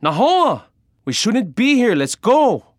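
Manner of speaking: high, loud urgent yelling; alarmed shouting at full pitch; desperate yell. Acted. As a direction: yell to get your brother moving. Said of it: "I yelled again".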